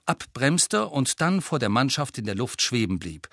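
usual speaking voice

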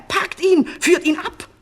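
high, loud frantic order; hoarse shouting. A narrator's reading. Enraged false king to his guards, hoarse shouting. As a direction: yell for the guards at full voice. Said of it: "Heiser schrie er".

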